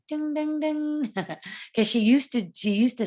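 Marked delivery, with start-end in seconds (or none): 0.1-1.1 high sing-song ding, ding, ding; bell chime. Retold or imitated. Seller spotting a sales chance, chiming high sing-song dings. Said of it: "And then I'm like".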